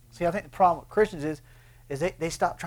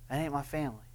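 a softer second take, "ain't" stressed as it trails off; quiet echo; 2 semitones lower, 9 dB quieter, faster than usual. Shown as a demonstration. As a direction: repeat "That ain't my family." much softer, the word "ain't" leaned on.

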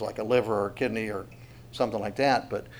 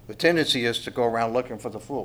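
ordinary delivery

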